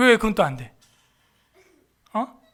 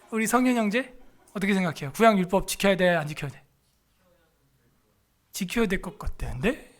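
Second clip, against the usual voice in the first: ordinary talk, nothing marked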